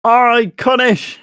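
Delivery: high, loud, slow drawn-out Iconish; slow loud mock-grand Iconish; mock announcer. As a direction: stretch Iconish out in a hammed-up high voice. Mocking name said drawn out.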